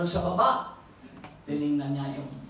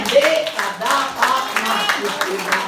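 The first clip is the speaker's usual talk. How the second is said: much louder, fervent preaching cry; preaching shout; 3 semitones higher, 10 dB louder than usual. Acted. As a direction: proclaim it at full force to the congregation. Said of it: "preaching at full force".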